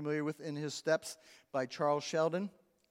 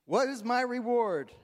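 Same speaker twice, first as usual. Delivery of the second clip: higher, louder, hammed-up demand "What is my reward?"; emphatic repeat; 8 semitones higher, 6 dB louder than usual. Shown as a demonstration. Preacher repeating the joke to congregation, louder and higher. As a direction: belt out "What" as a mock greedy plea. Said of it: "If you're married, you know".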